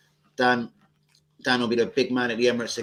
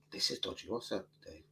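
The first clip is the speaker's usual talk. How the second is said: muttering rival fans; 3 semitones lower, 13 dB quieter than usual. Retold or imitated. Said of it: "I could see certain people".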